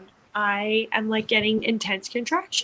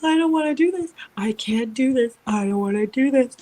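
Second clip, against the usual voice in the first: higher, louder, panicked begging; desperate pleading; 3 semitones higher, 5 dB louder than usual. Retold or imitated. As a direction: plead in panic as the pain peaks. Retold labor pain, begging to stop.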